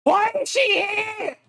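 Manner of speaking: exasperated high-pitched outburst; dismayed shout. Real, not acted. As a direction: shout in disbelief at an unwelcome arrival. Player dreads a returning character.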